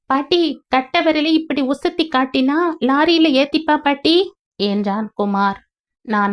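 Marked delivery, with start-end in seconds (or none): 0.1-4.2 put-on higher eager little boy; a kid explaining to grandma; child's voice. Boy showing grandma how to hitch a lorry, child's voice. Boy's line.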